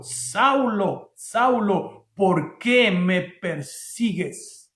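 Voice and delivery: very slow, deliberate question; measured heavenly voice, weighting every word. Acted. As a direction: call the name, then draw out each word. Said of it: "la voz le dijo".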